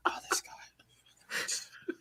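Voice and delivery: slow, whispered groan of annoyance; imitating annoyed Joker. Host voicing Joker seeing his foe, mock exasperation. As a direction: breathe it out wearily, imitating a fed-up villain. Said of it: "He was legit annoyed".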